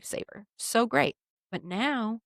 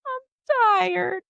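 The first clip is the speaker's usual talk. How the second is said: tired whine; 12 semitones higher, 6 dB louder than usual. Retold or imitated. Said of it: "I was like".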